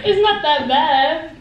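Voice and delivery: laughing through slow words. Real, not acted. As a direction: giggle while you say it. Laughing during bad taste test.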